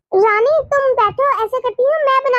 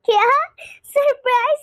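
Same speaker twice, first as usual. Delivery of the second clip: normal talking voice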